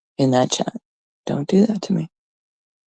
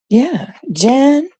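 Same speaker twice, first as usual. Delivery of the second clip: normal talking voice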